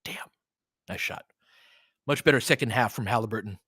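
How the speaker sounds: normal talking voice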